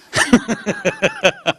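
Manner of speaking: high burst of laughter. Real, not acted. Laughing at own joke. (carcajada aguda)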